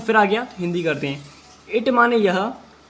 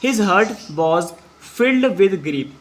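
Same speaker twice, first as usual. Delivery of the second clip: very slow English, each word apart; slow dictation; much slower than usual. Shown as a demonstration. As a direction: read it slowly so pupils can follow. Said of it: "आगे पढ़ते हैं". (धीमी गति से शब्द-दर-शब्द पढ़ना)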